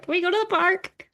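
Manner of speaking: put-on high childish whine; small kid asking for the park; kids begging. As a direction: pitch up like a pleading kid.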